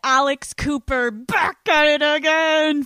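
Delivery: big loud show opener; energetic podcast greeting shout; loud intro shout. Real, not acted. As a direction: hype up the listeners.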